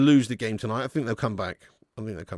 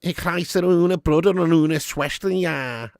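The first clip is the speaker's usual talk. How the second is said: put-on slow, higher-pitched Scouse-accented German; drawn-out Scouser attempting German; 3 semitones higher, slower than usual. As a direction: speak mock German like a Scouser. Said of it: "A Scouse German".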